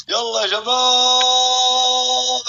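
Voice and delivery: high, very long-held shout; rallying holler. Real, not acted. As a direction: stretch the last word into a long yell. Rallies team in final seconds. (صيحة حماس عالية ممدودة)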